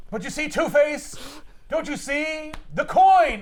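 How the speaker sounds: hammed-up pleading to Two-Face, high and rising to a loud cry; mock comic-book hero lecturing a villain; over-the-top superhero plea, climaxing loud on "The coin!"; Batman-style hero speech. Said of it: "a Batman book?".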